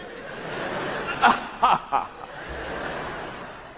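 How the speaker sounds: breathless unvoiced villain laugh; evil cackle. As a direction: wheeze out a gloating evil laugh. Evil giraffe gloats.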